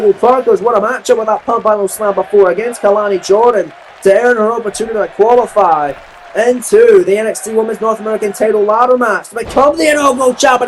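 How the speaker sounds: ordinary talk, nothing marked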